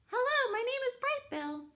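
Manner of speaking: very high chirpy little gosling. Acted. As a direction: greet brightly like a tiny bird. Gosling greets everyone.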